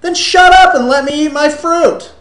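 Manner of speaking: loud, high exasperated retort; hammed-up fed-up outburst; mock exasperation. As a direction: yell it back at the complainer. Snapping back in acted quarrel.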